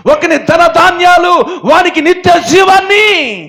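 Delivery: high, loud sermon at full force; fiery preaching. Real, not acted. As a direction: thunder it at the congregation. (ఉద్రేకంగా గట్టిగా ప్రసంగం)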